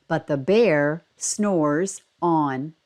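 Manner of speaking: slow, low refrain; reading the repeated line of a picture book slowly. A narrator's reading. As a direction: let the refrain drag out sleepily. Story refrain.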